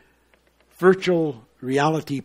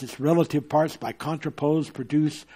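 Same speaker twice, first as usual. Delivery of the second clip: usual speaking voice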